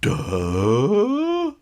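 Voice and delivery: loud high dopey duh; hammed-up dopey grunt; dumb bully grunt. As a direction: blurt a dim-witted duh.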